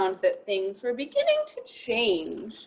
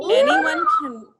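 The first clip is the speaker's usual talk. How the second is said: much higher and louder, bright shout; encouraging shout; 9 dB louder than usual. Real, not acted. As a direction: shout it out to cheer them all.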